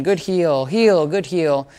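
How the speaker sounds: slow, even "good heal", each word set apart; a calm demo of the marker phrase "good heal"; drawn-out marker words. Shown as a demonstration. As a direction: mark "heal" slowly and clearly. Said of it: "I'm gonna be using a lot more".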